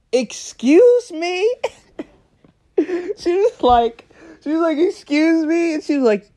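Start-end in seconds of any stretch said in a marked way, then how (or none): 0.1-1.5 high, drawn-out incredulous question; mock outrage. Fake mom feigns shock on phone. 4.8-5.7 high-pitched indignant mom; mock outrage. Repeat of feigned shock.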